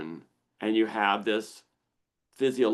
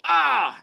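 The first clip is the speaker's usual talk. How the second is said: sharp high annoyed "Ah"; a quick high cry of irritation; annoyed grunt; 6 dB louder than usual. Retold or imitated. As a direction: let out a sudden irritated yelp. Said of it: "I'm gonna go".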